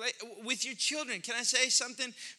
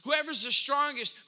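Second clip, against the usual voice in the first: ordinary talk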